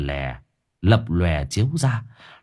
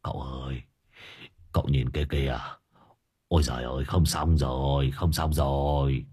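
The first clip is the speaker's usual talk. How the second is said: much lower and softer, stammering in shock; stammering in fright; 7 semitones lower, 6 dB quieter than usual. Acted. Servant Hải Nghẹo stammers to master, terrified and hushed. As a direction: gasp it out, too scared to speak properly. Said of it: "lắp bắp".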